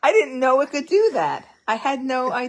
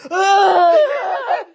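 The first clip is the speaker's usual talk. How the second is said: loud, high laughing shriek; laughing scream; 5 semitones higher, 9 dB louder than usual. Retold or imitated. Friend startled by the bull, loud laughing shriek. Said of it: "she's like".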